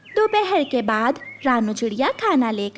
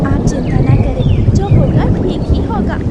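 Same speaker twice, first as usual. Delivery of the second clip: louder, calling reassurance over the storm; 9 dB louder than usual. Acted. Wife reassures over storm noise. (तूफ़ान में ज़ोर से तसल्ली देना)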